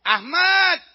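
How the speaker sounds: high, loud name call; acted shout to someone far off. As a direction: yell the name across the border. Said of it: "Tiba-tiba dia teriak".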